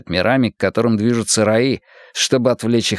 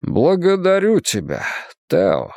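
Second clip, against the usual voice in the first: trying to sound sober; 7 semitones higher than usual. A narrator's reading. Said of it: "старательно выговаривая каждое слово".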